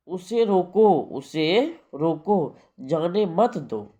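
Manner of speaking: उसे रोको set apart, then जाने मत दो slowly; pause after रोको. Shown as a demonstration. Teacher showing students the comma's meaning, pause after रोको. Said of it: "के बाद कोमा है".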